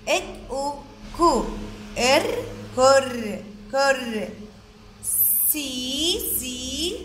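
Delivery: كرسي built up slowly, sound by sound. Shown as a demonstration. Teacher introducing كرسي to children, sound by sound. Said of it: "عنا كلمة".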